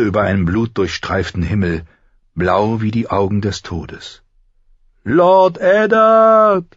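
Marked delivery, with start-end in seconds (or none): 5.0-6.7 very high, loud, long-drawn cry; Lyanna crying out. A narrator's reading. Lyanna calling to Eddard in his dream, crying out. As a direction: stretch the name into a distant scream. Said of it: "rief Lyanna erneut".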